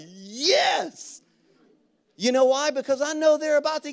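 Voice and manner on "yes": high, drawn-out triumphant yes; elated yes. Retold or imitated. Pastor welcoming someone's secret confession, elated. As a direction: celebrate it with a big, higher-pitched burst.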